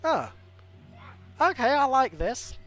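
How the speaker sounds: light up at the character; excited approval. Real, not acted. Streamer reacting to a new hero, with excited delight.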